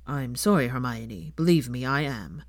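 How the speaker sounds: a minister's heavy regret; sombre Kingsley. Acted. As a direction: break the bad news gently. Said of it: "he said".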